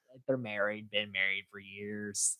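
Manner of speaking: ordinary talk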